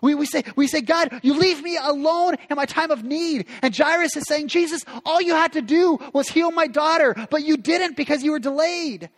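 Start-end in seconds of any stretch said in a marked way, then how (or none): 0.8-3.5 higher, accusing lament; frustrated complaint. Retold or imitated. Voicing complaint to God. 4.5-9.1 higher and louder, bitter reproach; grieving accusation. Retold or imitated. Jairus voiced accusing Jesus.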